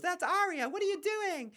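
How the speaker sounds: mocking, very high-pitched girlish squeal; Sansa. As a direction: put on a silly falsetto to mock her. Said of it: "Sansa totally a little drunk".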